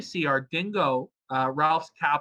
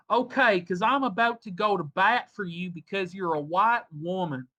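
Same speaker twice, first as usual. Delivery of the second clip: put-on higher, light Southern accent; 4 semitones higher than usual. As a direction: read the texts in a Southern accent. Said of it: "I can do a Southern accent".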